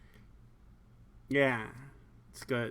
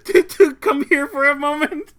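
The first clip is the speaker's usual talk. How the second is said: higher, laughing through it; 9 semitones higher, 9 dB louder than usual. Real, not acted. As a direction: read it while cracking up. Laughing while reading.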